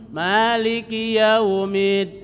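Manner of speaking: Maliki yaumid slow, lips meeting on mim; closed mim. Shown as a demonstration. Teacher repeats the mim demonstration. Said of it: "gitu ya".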